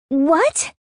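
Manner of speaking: high, rising shriek of disbelief. A narrator's reading. Sister hearing about the boyfriend, shrieking. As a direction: shriek it in shock, pitch shooting up. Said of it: "she shrieks".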